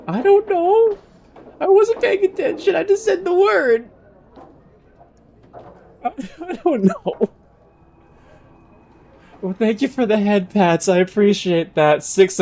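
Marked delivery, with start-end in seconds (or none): 0.1-7.3 squeaky flustered voice. Real, not acted.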